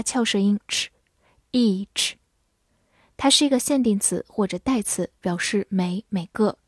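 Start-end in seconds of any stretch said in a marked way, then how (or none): 0.0-2.1 each said very slowly with a long ee and ch; each, long ee ending in ch; ch sound demo. Shown as a demonstration.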